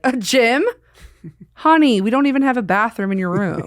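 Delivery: camp, mocking desk clerk; sassy clerk. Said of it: "They're like".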